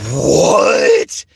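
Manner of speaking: loud, high disbelieving yelp; shocked what. Retold or imitated. Kid floored by the TV promo, loud and high. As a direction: blurt it out, floored. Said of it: "I'm like".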